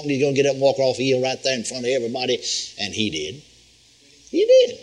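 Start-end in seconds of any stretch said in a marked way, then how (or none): 4.3-4.8 much higher, ringing "He did"; triumphant exclamation. Real, not acted. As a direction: shout the victory.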